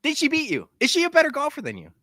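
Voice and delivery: usual speaking voice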